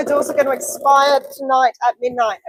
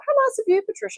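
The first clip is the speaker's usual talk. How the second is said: sing-song high thank you; high gushing; 10 semitones higher, 5 dB quieter, faster than usual. Acted. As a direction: gush it out, way up high.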